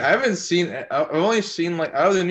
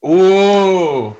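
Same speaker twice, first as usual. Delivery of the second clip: much louder, higher intrigued oh; surprised oh; 6 semitones higher, 13 dB louder than usual. Real, not acted. Reacting to Jacob's Pokimane stories, loud intrigued oh. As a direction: perk up at the juicy name.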